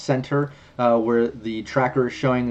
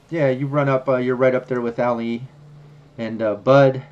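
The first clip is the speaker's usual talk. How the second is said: ordinary talk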